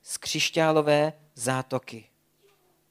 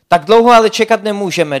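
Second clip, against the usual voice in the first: loud, higher objection; insistent Zlatka, raised voice; urgent protest; 5 semitones higher, 13 dB louder, faster than usual. A narrator's reading. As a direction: push back hard, louder.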